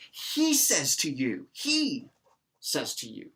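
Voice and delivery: He drawn out high and loud; slow, emphatic He at the front; stress on He. Shown as a demonstration. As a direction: punch He, then let "says to you" fall.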